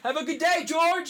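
high-pitched calling out; retold yelling. Canvasser calling after a student, high mock yell. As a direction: imitate a stranger hollering reassurance after someone. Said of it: "he kept yelling".

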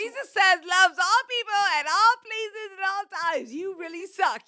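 high, very loud lecture; loud preachy scolding. Real, not acted. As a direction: belt it out over the other speaker. Mock scolding the friend.

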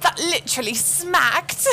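slow put-on British voice; hammed-up British slang; British accent. Keeps the British bit going.

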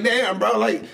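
high-pitched, worked-up complaining; exasperated rant to the barber. Retold or imitated. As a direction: act out venting at the barber, voice up high. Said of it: "I'm like".

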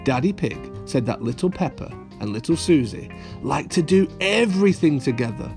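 usual speaking voice

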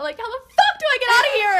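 high, loud, fast panic; panicked outburst. Retold or imitated. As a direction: blurt it out in desperation. Panicked retelling.

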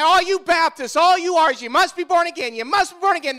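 mock the believers' slogan; lightly voiced scornful skeptics; mocking critics. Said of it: "they look at us and say".

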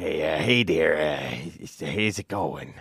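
very low, soft, halting greeting; creepy stranger. Acted. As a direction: mumble a hello as a shifty low-voiced visitor. New creepy stranger at the door.

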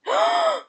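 jadeo agudo sorprendido; jadeo de sorpresa. Real, not acted. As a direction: ahoga un grito. Noticia de la eximición. (jadeo de sorpresa)

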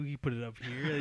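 usual speaking voice

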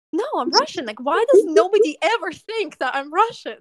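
high-pitched, exasperated retold protest; mock exasperation. Woman retelling her reply when taken for French, exasperated.

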